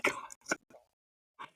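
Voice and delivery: breathless whispered oh my God; real stunned whisper; shocked whisper. Real, not acted. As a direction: gasp it under your breath. Shocked by the gross question.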